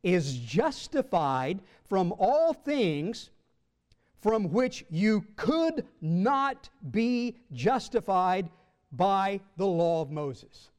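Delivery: very slow, word-by-word proclamation; emphatic scripture reading. Acted. Preacher reading Acts to his congregation, slow and emphatic. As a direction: land weight on each word of the verse. Reads Acts verse with heavy stresses.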